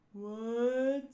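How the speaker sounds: lightly voiced slow talker; slow-witted character voice. Slow batter answers his teammate, drawn-out character voice. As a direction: stretch the word out in a slow character voice. Voicing slow-talking Mister Four.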